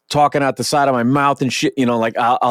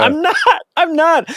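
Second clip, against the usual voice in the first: high-pitched mock exasperation; exasperated protest. Rapper answering bandmate who assumes he's from Jersey, exasperated. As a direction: protest it high, like you've said it many times.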